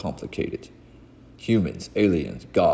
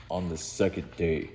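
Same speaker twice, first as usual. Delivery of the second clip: usual speaking voice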